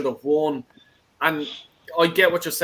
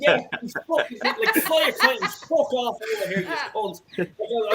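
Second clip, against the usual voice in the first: swearing through higher-pitched laughter; laughing; 6 semitones higher than usual. Real, not acted. Laughing fan tells the kite-flyers to clear off. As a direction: crack up while telling them off. Laughs after co-host's sugar joke.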